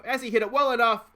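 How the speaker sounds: building play-by-play shout as the fly ball carries. Real, not acted. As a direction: lift the repeat into a real shout of hope. Louder repeat as ball flies.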